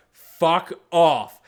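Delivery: imitated annoyed outburst; mock dismissive yell. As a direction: voice a dog loudly rejecting a suitor. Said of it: "And Cheeky was like".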